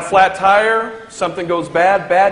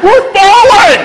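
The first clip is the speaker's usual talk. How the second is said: panicked shout; 12 semitones higher, 9 dB louder, slower than usual. Retold or imitated. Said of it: "And I said".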